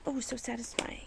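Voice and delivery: imitating a satisfied whisper; whispered delight. Crafter imagining a smooth stitch, whispering happily. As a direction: breathe it out like pure relief. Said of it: "I'd be like".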